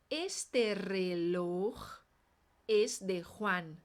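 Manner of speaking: "Este reloj" stretched right out, "es de Juan" slow with throat J; slow drill. Shown as a demonstration. Teacher slowing way down so students can repeat. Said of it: "vamos treinar".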